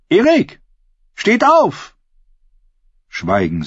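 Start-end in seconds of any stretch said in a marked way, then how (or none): 0.1-2.0 high, loud female voice daring him; woman's challenge. A narrator's reading. Woman on the steps challenges old Erik, loud and high. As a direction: call on him to stand. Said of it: "sagte sie".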